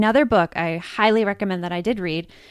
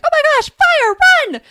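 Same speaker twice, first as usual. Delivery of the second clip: high, loud mock panic shout; panicked alarm; 10 dB louder than usual. Host voices the brain's stress response, in mock panic. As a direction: sound the alarm in exaggerated panic. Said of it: "amygdala is your stress response".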